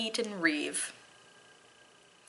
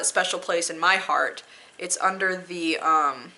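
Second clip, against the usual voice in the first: normal talking voice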